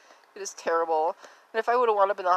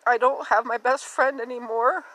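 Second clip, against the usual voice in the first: ordinary talk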